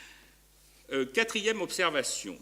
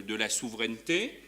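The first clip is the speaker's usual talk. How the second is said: ordinary talk, nothing marked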